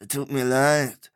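slow, sheepish apology; mild embarrassed mumble; a touch of awkward innkeeper. A narrator's reading. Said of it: "erklärte der Mann verlegen".

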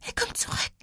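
choked, whispered warning. A narrator's reading. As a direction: whisper it softly as a little girl choking back tears. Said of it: "wiederholte die Kleine mit erstickter Stimme". (mit erstickter Stimme geflüstert)

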